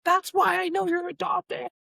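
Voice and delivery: very high, squealed sibling comeback; mock screaming retort. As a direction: shriek it like a furious kid, in imitation. Screamed retort in retold fight.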